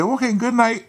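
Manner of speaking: high-pitched, airy good night; imitated woman. Woman to man in bed, high breezy sign-off. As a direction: put on her high, breezy voice.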